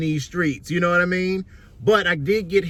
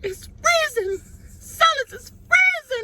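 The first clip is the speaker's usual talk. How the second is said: high squealing complaint of cold; slower than usual. Real, not acted. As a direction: squeal about the freezing cold.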